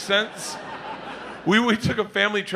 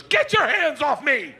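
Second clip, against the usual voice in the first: high, drawn-out protest; mock outraged kid, pitched way up; indignant daughter; 8 semitones higher than usual. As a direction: imitate a kid protesting being grabbed. Daughter resisting being grabbed by dad.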